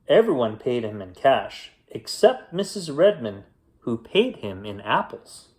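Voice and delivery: ordinary delivery, nothing marked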